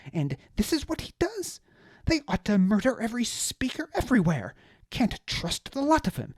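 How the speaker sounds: high, loud, outraged aristocrat ranting; indignant high-voiced noble. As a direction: spit out the betrayal in a high indignant voice. Deliritus ranting continues.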